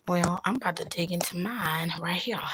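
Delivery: hushed unvoiced whisper. Real, not acted. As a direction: whisper it close to the mic. Whispering before eating.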